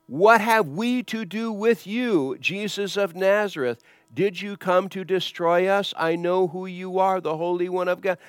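high-pitched, insistent demon voice; demonic spirit. Retold or imitated. Demonic spirit confronts Jesus of Nazareth, high insistent cry. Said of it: "the demonic spirit".

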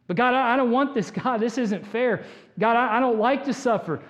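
pleading objection; protesting plea. Acted. Acted protest to God.

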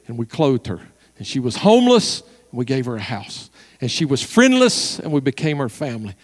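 hushed phrases with long pauses, key words lifted; solemn preaching. Real, not acted. Preacher telling a woman's painful story, solemnly.

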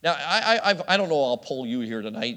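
usual speaking voice

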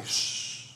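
long unvoiced "shh"; shushing. Shown as a demonstration. Preacher cueing the church to shush, long hush. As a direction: hush the room with a drawn-out hiss. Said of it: "Somebody say".

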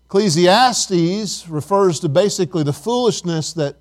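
usual speaking voice

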